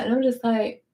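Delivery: normal talking voice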